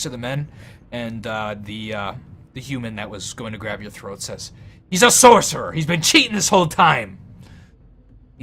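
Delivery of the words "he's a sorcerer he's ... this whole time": loud, high, outraged cry; angry accusation. Acted. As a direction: denounce a cheat to the room. Human accuses player of cheating.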